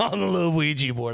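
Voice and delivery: laughing while repeating it; tickled, chuckling echo; amused echo. Real, not acted.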